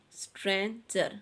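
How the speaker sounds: Stranger split in two, stran and jer; syllable by syllable. Shown as a demonstration. Teacher showing children a two-syllable word, syllables separated. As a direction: break stranger cleanly between its two syllables. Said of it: "आपने इसके इस तरह से syllables".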